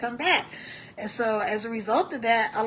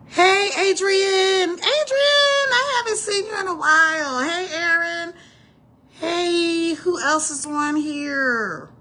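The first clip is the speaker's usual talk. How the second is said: higher, louder, stretched hellos; excited greeting; 7 semitones higher, 5 dB louder than usual. Real, not acted. Host greeting viewers joining the stream, excited hellos. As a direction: call out to friends joining.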